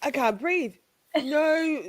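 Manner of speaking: usual speaking voice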